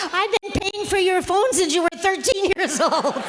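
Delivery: giggling teasing jab; laughing. Retold or imitated. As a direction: crack up while teasing. Teasing son about phone bills.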